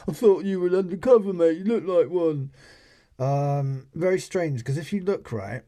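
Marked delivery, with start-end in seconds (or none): 0.1-2.5 quick, loud mock lad's voice; retold parting jibe. Guy walking away calling back to the man, loud cheeky mock voice. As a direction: fire it off cheekily, faster. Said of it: "he goes off and says".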